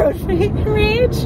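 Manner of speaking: laughing. Real, not acted. Laughing at own slip.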